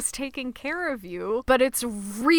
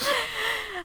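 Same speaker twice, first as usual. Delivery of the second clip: high laugh; 12 semitones higher, 4 dB quieter than usual. Real, not acted. Laughs after the ASMR bit.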